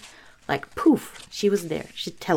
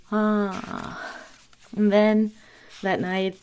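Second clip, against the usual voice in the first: usual speaking voice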